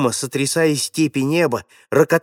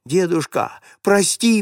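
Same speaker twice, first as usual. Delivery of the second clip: pleading; 6 semitones higher than usual. A narrator's reading. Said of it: "взмолился Лёнька".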